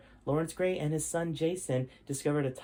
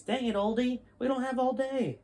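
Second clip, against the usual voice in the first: put-on much higher, exasperated kid voice; impatient teen; 10 semitones higher than usual. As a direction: whine at the old man to hurry. Jason impatient with Larrabee.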